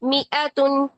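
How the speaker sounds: मिअतुन with the alif dropped; मिअतुन recited as mi-a-tun; careful मिअतुन, no long a; Qur'anic recitation. Shown as a demonstration. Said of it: "इस तरीके से पढ़ना है".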